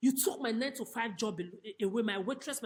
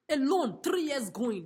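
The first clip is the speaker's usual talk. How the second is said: louder, slow, stressing alone; slow defiant stress; 4 semitones higher, 6 dB louder, slower than usual. Real, not acted. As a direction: lean hard on alone.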